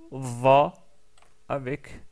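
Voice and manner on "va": "va" with an open a, said firmly; Arabic with fatha. Shown as a demonstration. Teacher voweling the letter with fatha for students. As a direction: give "va" a full open vowel. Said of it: "on prononce avec la fatha".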